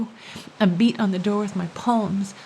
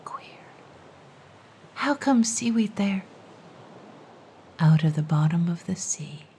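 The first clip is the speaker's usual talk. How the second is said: whisper; 3 semitones lower than usual. Acted. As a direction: trade riddles in a hush. Whispered riddle dialogue.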